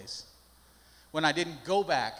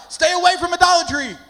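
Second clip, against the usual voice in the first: "Stay away from idolatry" shouted high and loud, with "away" hammered hard; a pulpit-pounding sermon shout; preaching shout; 12 semitones higher, 14 dB louder than usual. Shown as a demonstration. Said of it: "I'm preaching, baby".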